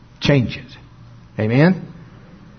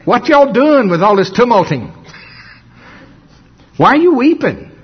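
higher, incredulous folksy questioning; raised-pitch folksy mock scolding; colloquial Jesus paraphrase; 6 semitones higher than usual. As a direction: call out in down-home disbelief at the fuss. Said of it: "What would he say?".

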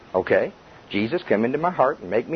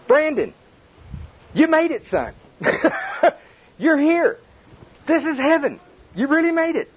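Jesus greeting; 14 semitones higher than usual. Retold or imitated. Preacher voicing Jesus welcoming Brandon to heaven, high and joyful. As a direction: welcome him home with delight.

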